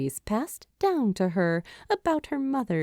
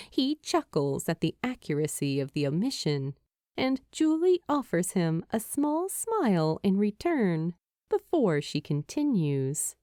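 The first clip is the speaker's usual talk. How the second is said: usual speaking voice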